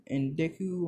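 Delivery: ordinary talk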